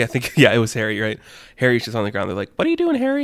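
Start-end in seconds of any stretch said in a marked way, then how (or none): 2.6-3.2 very high cartoon squeak; squeaky minion voice. Minions find Harry on the ground, squeaky minion voice. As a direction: ask it in a tiny, high minion voice.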